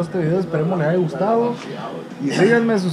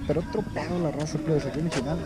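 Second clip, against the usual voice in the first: lowered, confidential voice; quiet, low-pitched aside; not out loud; 4 semitones lower, 11 dB quieter, faster than usual. Real, not acted. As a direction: say it softly, as if keeping it from others. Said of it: "en voz alta porque se".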